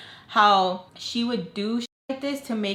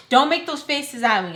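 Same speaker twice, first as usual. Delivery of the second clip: louder, higher mock telling-off; retold scolding; 5 semitones higher, 5 dB louder than usual. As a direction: imitate an annoyed scolding. Retold scolding by the annoyed woman.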